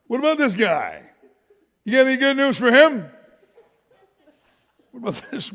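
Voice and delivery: loud, high, laughing disbelief; preacher laughing at Peter's odd question; laughing mock outrage. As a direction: laugh while mocking the question.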